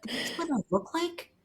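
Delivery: can't believe that's her; seeing herself on the laptop, high and amazed; amazed disbelief. Retold or imitated. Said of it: "I literally went".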